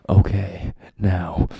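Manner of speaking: loud overdone anime line. Retold or imitated. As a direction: belt it like an anime actor. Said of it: "Oh, God, please stop".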